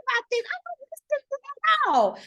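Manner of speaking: slow, very high mock wail; imitated crying. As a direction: re-enact a tearful breakdown in a squeaky pitch. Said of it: "and I started crying".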